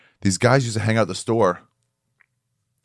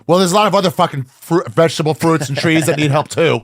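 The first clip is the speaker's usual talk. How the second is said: imitating an indignant scold, higher and louder; mock outrage; 7 semitones higher, 4 dB louder than usual. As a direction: butt in with a louder, high-pitched complaint. Mocking an angry online scold.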